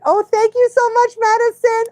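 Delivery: high, loud, delighted thank-you; excited thanks. Real, not acted. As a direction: gush thanks to a viewer. Thanking a viewer for a gift.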